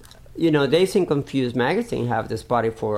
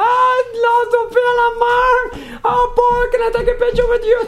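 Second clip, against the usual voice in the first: high, loud, fast squealing fan voice; gushing fan; 4 dB louder than usual. Retold or imitated. Sophia mimics the Korean fan begging for a photo, squealing high.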